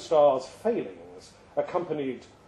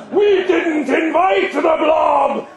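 huge, slow, higher bellow; 11 semitones higher, 11 dB louder, slower than usual. Acted. Crond refusing to invite the blob, bellowing. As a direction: bellow it out, drawn slow and loud. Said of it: "bellowed Crond".

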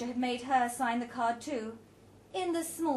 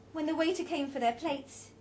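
ordinary delivery

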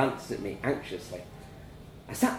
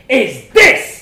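very loud, high shouting; furious shout; 11 semitones higher, 18 dB louder than usual. A narrator's reading. Parents shouting at each other over the child. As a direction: blast it across the table.

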